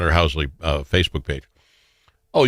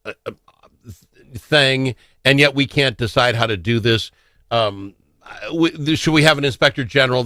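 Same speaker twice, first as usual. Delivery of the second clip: ordinary talk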